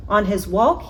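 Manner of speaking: ordinary delivery, nothing marked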